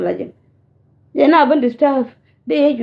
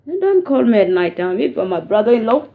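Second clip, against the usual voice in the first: ordinary talk, nothing marked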